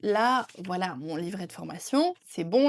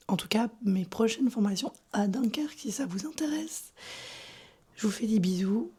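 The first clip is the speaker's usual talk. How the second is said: lost voice. Real, not acted. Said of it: "j'ai plus de voix".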